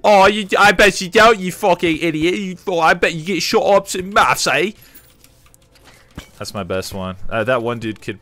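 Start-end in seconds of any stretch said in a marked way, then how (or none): 0.0-4.7 loud, high mocking British accent. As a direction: roast him like a rowdy British bloke, loud and high and slow. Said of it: "He roasts me with a British accent".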